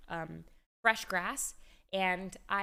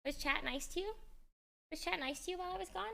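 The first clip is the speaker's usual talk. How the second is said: pet talk; 9 semitones higher, 5 dB quieter than usual. Real, not acted. Owner checking on her dog after stepping away, high pet talk. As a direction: coo high and softly at a puppy.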